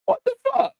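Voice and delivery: mock-shocked high-pitched exclamation; high disbelieving squeak. Real, not acted. Laughing disbelief after a laugh.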